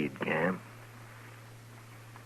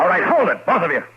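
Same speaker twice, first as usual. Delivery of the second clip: much higher, louder order to stop; commanding shout; 13 semitones higher, 8 dB louder, faster than usual. Acted. Marshal breaks up the two men, loud command. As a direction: cut the fight off hard.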